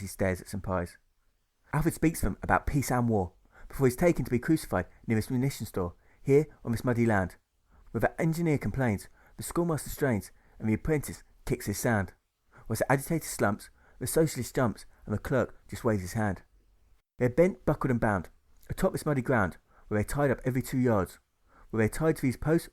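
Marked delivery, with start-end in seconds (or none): none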